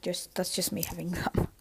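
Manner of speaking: quick whispered aside. Real, not acted. Quiet aside about chewing.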